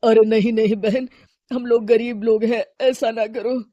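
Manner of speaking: a poor man begging not to be banned; lightly voiced hawker pleading; pleading vegetable seller.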